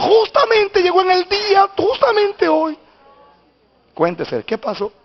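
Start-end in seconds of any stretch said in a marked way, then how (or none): 0.0-2.8 high, ringing mock-joyful cry; imitating happy woman. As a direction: exclaim it as the delighted mother, stretching the end. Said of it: "Contenta, me dijo". (imitando a una madre contenta)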